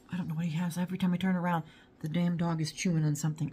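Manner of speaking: ordinary delivery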